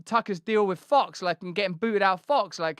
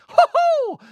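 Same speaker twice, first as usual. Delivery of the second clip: very high loud hoo-hoo hoot; hammed-up high hooting laugh; mad laugh; 8 dB louder than usual. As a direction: hoot like a manic anchor. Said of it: "their mad laughs".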